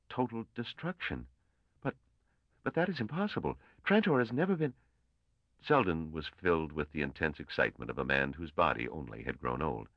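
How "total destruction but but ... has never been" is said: stunned disbelief, pitch climbing much higher; can't believe the figures, voice rising high; unbelieving. A narrator's reading. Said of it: "Gale said unbelievingly".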